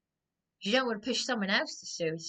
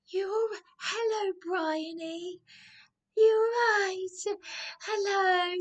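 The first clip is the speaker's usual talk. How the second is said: high, sing-song sugary hello, much higher; syrupy mock hello, pitched way up, drawn-out "You all right?"; mocking fake greeting; 9 semitones higher, slower than usual. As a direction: mimic the fake-nice welcome they gave her. Mocks their fake friendly greeting.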